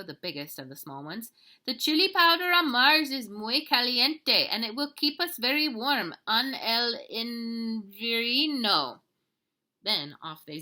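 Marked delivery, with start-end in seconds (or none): 1.6-9.0 loud, high, theatrical Spanish-flavoured proclaiming, slowing at the end; chihuahua leader. Acted. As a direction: roll out the plan grandly. Don Diego explains the plan.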